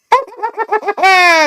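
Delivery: high, loud mock cackle; rapid high staccato fake laugh; imitated background laughter. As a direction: imitate someone laughing hard, high-pitched. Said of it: "someone's laughing in the back".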